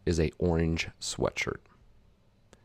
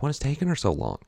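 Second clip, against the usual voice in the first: higher-pitched, mildly exasperated retold question; mock frustration at being kept waiting; frustrated; 7 semitones higher, much faster than usual. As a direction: act out impatient waiting, pitched up. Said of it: "I'm frustrated 'cause I'm like".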